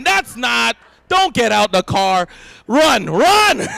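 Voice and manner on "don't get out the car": normal talking voice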